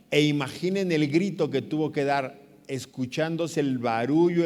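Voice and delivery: normal talking voice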